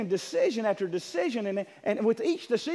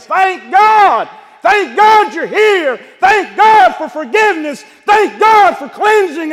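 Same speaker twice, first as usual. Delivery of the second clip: high, loud, slow thankful cries; grateful shout; 9 semitones higher, 15 dB louder, slower than usual. Acted. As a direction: shout thanks like a man set free. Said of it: "I ran and I worshiped and I said".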